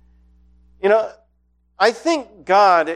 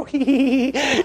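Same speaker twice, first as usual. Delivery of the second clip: high, sneaky little cackle; hammed-up creepy critter laugh; critter giggle; 7 semitones higher, slower than usual. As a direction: giggle like a mischievous gremlin. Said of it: "shut the door and go".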